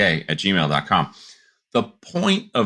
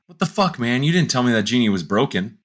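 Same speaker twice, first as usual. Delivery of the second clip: annoyed complaint; 6 semitones higher than usual. Retold or imitated. Angry customer back at bar.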